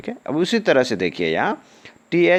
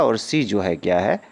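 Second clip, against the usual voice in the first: normal talking voice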